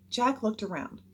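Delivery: plain reading voice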